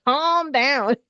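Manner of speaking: escalating nag from a know-it-all friend; pushier "calm down". Retold or imitated. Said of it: "somebody telling you to calm down".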